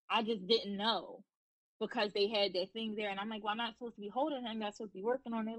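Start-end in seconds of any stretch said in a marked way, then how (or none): 3.4-5.6 fast, rushed retold protest; hurried retelling. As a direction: rattle it off quickly, half to yourself. Retold protest to hospital staff.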